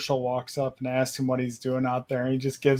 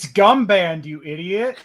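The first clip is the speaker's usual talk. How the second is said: mock scolding; 5 semitones higher, 8 dB louder than usual. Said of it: "they were like".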